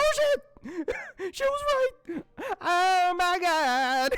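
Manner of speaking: very high, loud shocked yell; shocked shout. Retold or imitated. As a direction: scream in disbelief.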